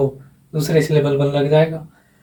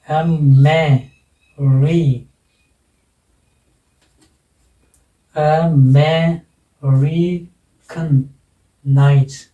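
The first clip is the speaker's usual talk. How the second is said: "American nights" drawn out slowly, piece by piece; syllable by syllable; 3 dB louder, much slower than usual. Shown as a demonstration. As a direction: break "American nights" into long separate syllables. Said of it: "कितने syllable हो गए?".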